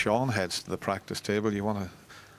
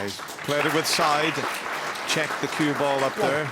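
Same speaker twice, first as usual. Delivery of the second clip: excited; 6 semitones higher, 5 dB louder than usual. Real, not acted. Reacting to a clever shot.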